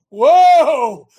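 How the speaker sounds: high, loud warning whoa. Shown as a demonstration. Host repeats the warning whoa to viewers, loud. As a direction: halt them with a loud whoa. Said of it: "he is saying W H O A".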